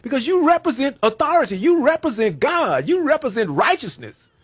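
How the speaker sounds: loud, high impassioned preaching; rising sermon fervour, building to a peak; preaching at full force. Acted. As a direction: preach it out to the whole church. Preaching to the congregation.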